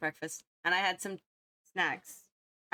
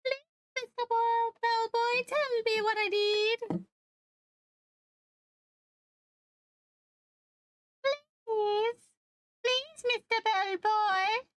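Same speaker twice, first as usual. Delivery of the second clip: high bellboy voice; slower than usual. Acted. Voicing the pleading bellboy character.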